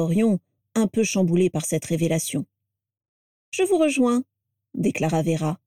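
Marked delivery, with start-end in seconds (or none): 3.5-4.2 higher, lightly falling tone; lightly put-off reply, pitched up; light assurance. A narrator's reading. Vera lets the men leave without her, light assurance.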